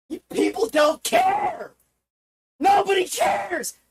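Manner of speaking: high, wailing shout; anguished accusation. Real, not acted. Streamer accusing viewers nobody cares, high anguished cry.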